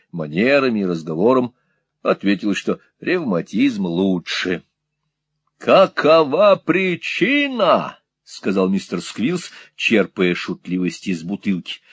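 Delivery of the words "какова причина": slow, high, drawn-out question; loud questioning. A narrator's reading. Squeers questions Peg about rheumatism, loud and slow. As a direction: call the question out syllable by syllable. Questioning deaf woman loudly.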